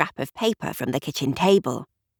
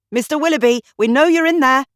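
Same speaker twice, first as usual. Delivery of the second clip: much higher, louder commanding shout; police shout; 9 semitones higher, 7 dB louder, faster than usual. A narrator's reading. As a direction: bark at the locked cabin door. Shouting through cabin door.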